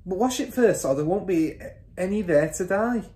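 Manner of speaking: high-pitched warning; mother's voice. A narrator's reading. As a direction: instruct him as the wife. Mother instructs husband.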